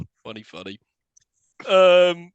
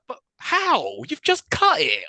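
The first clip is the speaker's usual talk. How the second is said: higher, baffled "but how?"; mock incredulous squeak; baffled disbelief; 13 semitones higher, much slower than usual. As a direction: react as if the plot just cheated you. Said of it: "And I'm like".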